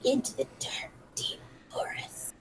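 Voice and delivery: very soft, voiceless whisper; hushed mock-ominous whisper; spooky whisper. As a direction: whisper it like an eerie aside. Spooky whispered aside on the scene.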